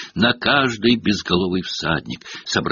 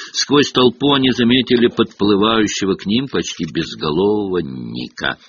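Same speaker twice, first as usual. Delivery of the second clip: ordinary delivery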